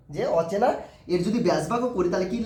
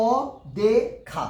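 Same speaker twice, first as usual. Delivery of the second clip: অদেখা stretched very slowly, syllables pulled apart; slow syllable demo of অদেখা; 2 semitones higher, much slower than usual. Shown as a demonstration. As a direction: drag out অদেখা to count its syllables. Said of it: "দেখো অচেনা কবারে বলছি?".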